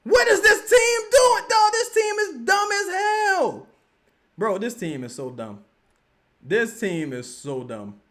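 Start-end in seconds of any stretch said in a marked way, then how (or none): 0.0-3.7 slow, high, loud outraged yell; furious shout. Real, not acted. Streamer yelling at the team on TV, slow high furious shout. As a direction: shout your disgust at the team.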